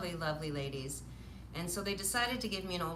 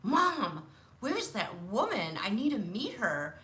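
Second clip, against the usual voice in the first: put-on eager child asking mom; 4 semitones higher, 5 dB louder than usual. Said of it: "I looked at my mom and I said".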